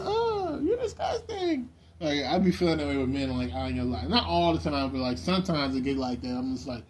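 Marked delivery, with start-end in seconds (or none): 0.0-1.7 very high squeal with a laugh; disgusted TV girl. Retold or imitated. Imitating girls on TV shows, high mock-disgusted squeal. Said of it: "they be like".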